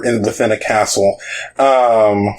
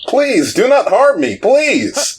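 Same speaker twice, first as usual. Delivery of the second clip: very high, wailing plea; pleading king; 11 semitones higher, slower than usual. Acted. Evil king begs the adventurers for mercy, pleading. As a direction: beg in a high, cowering voice. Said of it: "under some kind of enchantment".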